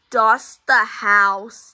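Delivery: slow, deliberate dust the house; slow emphatic order. Acted. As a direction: spell out the order word by word. Dad repeats the order slowly.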